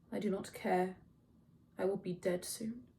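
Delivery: soft, drained reply; listless indifference. A narrator's reading. Grieving Achilles dismissing Agamemnon, quiet and indifferent. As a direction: say it quietly, past caring.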